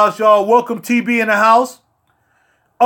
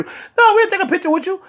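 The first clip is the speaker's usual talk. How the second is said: mimicking women; 10 semitones higher than usual. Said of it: "They said".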